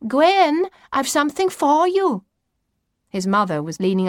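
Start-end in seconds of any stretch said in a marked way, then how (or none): 0.1-2.2 high, raised motherly call. A narrator's reading. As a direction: call to a child out of the window. Mother calls from the kitchen window.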